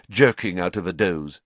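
usual speaking voice

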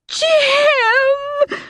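very high, drawn-out scream. A narrator's reading. Girl screaming for the dead boy, high and anguished. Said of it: "Susie screamed".

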